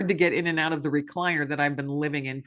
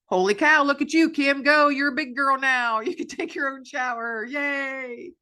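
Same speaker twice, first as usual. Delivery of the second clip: much higher, jubilant praise; excited cheering; 9 semitones higher than usual. Retold or imitated. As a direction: cheer yourself on like praising a toddler. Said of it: "first time taking a shower".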